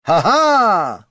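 loud, high booming laugh; big heroic trumpet laugh. A narrator's reading. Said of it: "laughed a voice like a trumpet".

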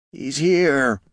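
a scared bandit barely getting it out; mild frightened whimper. A narrator's reading. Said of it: "whimpered the man".

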